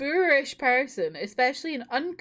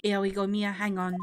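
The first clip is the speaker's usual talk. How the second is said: ordinary talk, nothing marked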